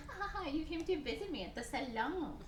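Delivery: high, softly laughing coquette; delighted girlish greeting, hammed up high; gushing Roxanne. Roxanne greets her suitor gushingly.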